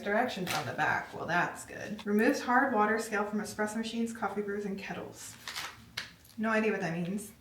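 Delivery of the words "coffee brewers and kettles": ordinary talk, nothing marked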